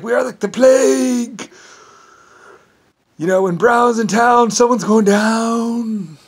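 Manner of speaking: high, loud voice with long-drawn words; Mister Brown's boast. Retold or imitated. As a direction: proclaim it slowly, stretching out the last word. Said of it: "He was like".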